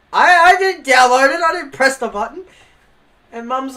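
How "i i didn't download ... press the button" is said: high loud laughing defence; loud laughing protest. Retold or imitated. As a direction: shout your innocence through laughter. Family yelling, teen protesting.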